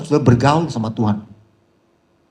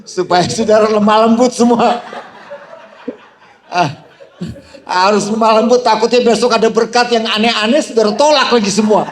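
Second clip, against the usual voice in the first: loud, high, laughing through the words; amused loud preaching with laughter; laughing preacher joke; 7 semitones higher, 5 dB louder than usual. Real, not acted. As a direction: tease the congregation while laughing.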